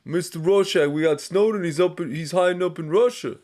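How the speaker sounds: hammed-up Brooklyn accent; Brooklyn wiseguy impression. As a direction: play the excited Brooklyn wiseguy. Impression asked about after.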